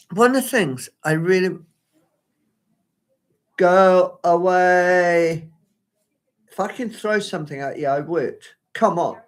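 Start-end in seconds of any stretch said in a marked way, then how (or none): 3.6-5.5 drawn-out loud go away. Real, not acted. Noise outside her window.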